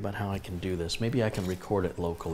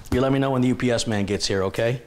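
faster, louder chatter to the dog; playful pet talk; 3 semitones higher, 6 dB louder, faster than usual. Real, not acted. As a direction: hype the dog up. Talking to dog excitedly.

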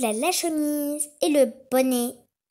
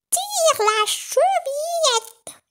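put-on very high, slow, louder happy old lady; joyful grandmother; 6 dB louder, much slower than usual. A narrator's reading. Grandmother joyfully calls her granddaughter in, very high. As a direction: call out gladly like a granny. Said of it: "s'écria la grand-mère, toute joyeuse".

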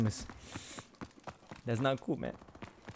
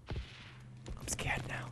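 very slow, hushed whisper; scared whisper while hiding from enemies; 16 dB quieter, much slower than usual. Real, not acted. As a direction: barely breathe it, hiding.

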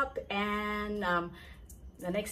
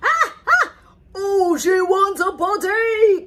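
loud, high shouted alarm; shouting general; 10 semitones higher, 11 dB louder than usual. Acted. The general shouting for a potty, loud. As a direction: bellow the warning. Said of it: "cried the general".

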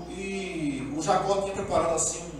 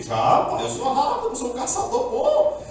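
laughing boast; 8 semitones higher, 4 dB louder than usual. Retold or imitated. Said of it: "Jacó boasting".

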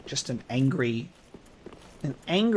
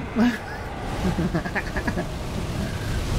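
long, breath-only chuckle; helpless laugh; 2 semitones higher, 3 dB quieter than usual. Real, not acted. Player swinging desperately at the boss, helpless laugh. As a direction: laugh through the struggle.

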